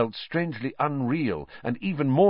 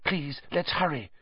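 whispered; 6 semitones higher, 6 dB quieter than usual. A narrator's reading. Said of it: "Hermione whispered".

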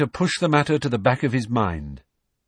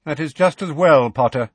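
cold, louder Snape voice; 6 semitones higher, 6 dB louder than usual. A narrator's reading. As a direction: say it icily. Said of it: "said Snape coldly".

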